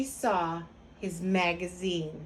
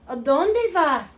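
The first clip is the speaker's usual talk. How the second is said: loud, slow, raised call; 5 semitones higher, 8 dB louder, slower than usual. A narrator's reading. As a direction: hail your friends across the river. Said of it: "called out Scapito".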